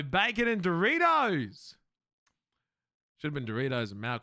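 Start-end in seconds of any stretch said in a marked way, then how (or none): 0.0-1.8 drawn out and loud, half laughing; excited. Real, not acted. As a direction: call it out like a treat. Excited food call-out.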